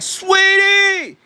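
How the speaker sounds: very high, loud, drawn-out holler; high-pitched mimicking shout; imitated call. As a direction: shriek it out long, mimicking someone. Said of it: "but the way he said it".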